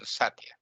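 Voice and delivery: Satya dropped low and soft, front-stressed; bad pronunciation. Shown as a demonstration. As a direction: say Satya as SAT-ya, thrown away. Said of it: "Bad pronunciation".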